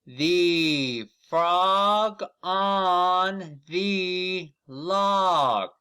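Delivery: very slow, high and loud, each word stretched; slow model reading. Shown as a demonstration. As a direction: read it out slowly for children to copy. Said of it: "Let's read it together".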